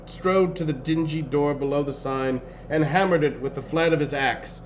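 ordinary delivery, nothing marked

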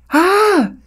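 high-pitched cry of shock; startled scream. Acted. Onlooker reacts to the hypnotic illusion, startled scream. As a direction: scream out in alarm.

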